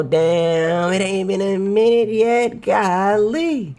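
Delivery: high, stretched wail of amazement; drawn-out disbelief. Real, not acted. Viewer amazed a minute hasn't passed, high comic wail. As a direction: wail it in one long comic line.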